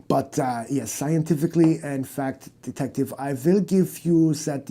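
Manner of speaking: a lightly voiced German aristocrat; German accent. German doctor explaining his family, in a German accent. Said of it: "Your stupid accent".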